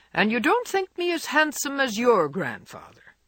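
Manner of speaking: a hint of a crusty grandfather teasing; lightly voiced gruff old man. A narrator's reading. Old gentleman teases Jo about handsomeness, mock gruff.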